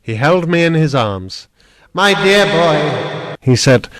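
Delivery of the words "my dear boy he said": tender relief. A narrator's reading. Uncle embracing rescued boy.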